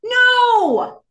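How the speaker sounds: sudden high, loud theatrical shout; yelled refusal. Acted. Host voicing a listener's email rant, high mock-outraged yell. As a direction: burst out with an emphatic yell. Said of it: "I apologize for yelling at you".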